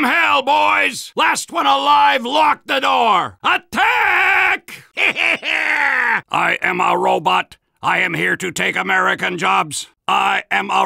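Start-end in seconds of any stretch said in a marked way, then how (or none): 5.0-6.2 short bellowing war laugh; triumphant laugh. Acted. After ordering an attack.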